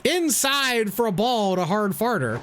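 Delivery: baseball announcer play-by-play. Acted. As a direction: call the game like a broadcaster. Plays announcer in game.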